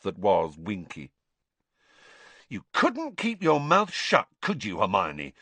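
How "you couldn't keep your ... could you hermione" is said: angry Ron. Acted. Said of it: "said Ron angrily".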